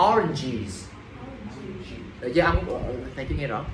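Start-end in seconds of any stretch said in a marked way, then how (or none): 0.1-0.8 ORANGES lower, with a strong j before the final iz; stronger J. Shown as a demonstration. Teacher asks for a stronger J, ORANGES with firm j. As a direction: press on the j in ORANGES. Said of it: "J mạnh chút xíu thầy".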